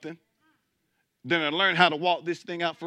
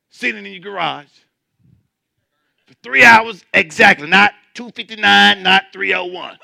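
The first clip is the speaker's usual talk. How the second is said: mocking, laughing; 4 semitones higher, 13 dB louder than usual.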